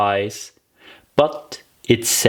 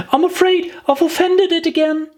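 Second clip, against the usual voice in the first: put-on much higher, rueful girlish voice; sorrowful. Alice regretting she upset the mouse, a touch sorrowful. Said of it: "in a sorrowful tone".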